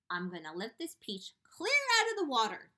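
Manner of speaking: firm announcement; firmly announced. Acted. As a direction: state it with conviction. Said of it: "James announced firmly".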